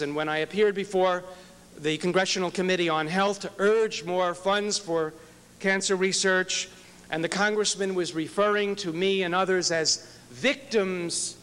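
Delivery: ordinary delivery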